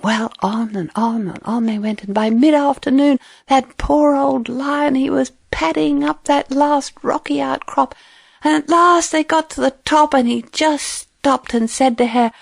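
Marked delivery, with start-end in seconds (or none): none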